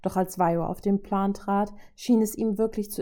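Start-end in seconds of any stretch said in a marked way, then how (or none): none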